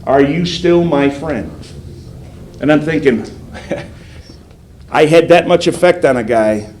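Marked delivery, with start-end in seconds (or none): 0.0-1.5 slow quoted question. Retold or imitated. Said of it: "And he would say".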